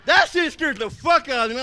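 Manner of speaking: ordinary delivery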